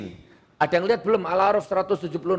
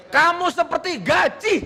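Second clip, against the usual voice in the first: high shouted jeer breaking into laughter; playful mock insult; 10 semitones higher than usual. Shown as a demonstration. Tries local insult, then laughs. (ejekan lantang bernada tinggi sambil tertawa)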